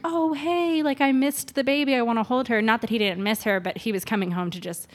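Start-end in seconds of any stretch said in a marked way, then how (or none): 0.0-2.6 cheerful mock greeting. Husband imagined greeting baby happily.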